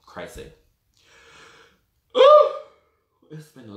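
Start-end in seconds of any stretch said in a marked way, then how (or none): 2.2-2.5 very high, loud burst; sudden ooh. Real, not acted. Host breaking off the story with a sudden squeal. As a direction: squeal it out of nowhere.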